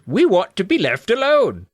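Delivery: high-pitched gnome voice; younger gnome. Acted. Young gnome wants privacy, high gnome voice. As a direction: plead high like a tiny creature. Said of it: "mixed up the voices".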